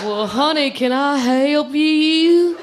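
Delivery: very slow, drawn-out Southern drawl; syrup-slow Southern sweetness, rising high; Southern accent. Acted. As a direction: stretch every word like an unhurried Southern helper. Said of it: "I love that Southern accent".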